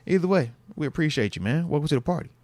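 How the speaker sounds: normal talking voice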